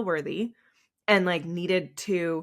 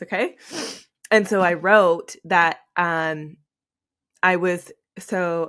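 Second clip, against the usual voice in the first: usual speaking voice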